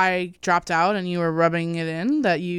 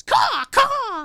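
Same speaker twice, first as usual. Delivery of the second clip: shrill screeching bird woman. Pretend. Crow call bit.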